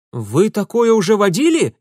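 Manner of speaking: high startled cry; вскрик. Acted. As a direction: cry it out in alarm. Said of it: "вскричал Урусов". (растерянный вскрик)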